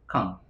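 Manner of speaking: "quand" said as kam, nasal vowel, no u and no d; French quand. Shown as a demonstration. Teacher showing how quand sounds in French. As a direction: say "quand" like kam, never kuang. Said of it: "dibacanya bukan. kuang, tapi kam".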